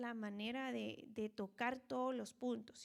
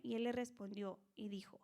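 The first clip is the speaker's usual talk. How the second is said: ordinary talk, nothing marked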